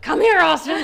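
high, loud summons through laughter; mock angry yell. Angry sister chasing her brother, mock yelling. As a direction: imitate a furious chaser, half laughing. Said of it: "Tiffany was pissed".

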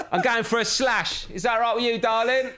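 high, laughing cockney builder. Pretend. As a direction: play a cheeky builder. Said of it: "Oi love".

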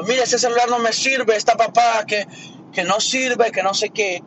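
mock-angry customer snapping at the store clerk; complaining at the phone store, irritated and loud. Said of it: "si yo te vengo con odio y te vengo todo enojado".

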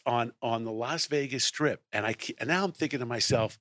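normal talking voice

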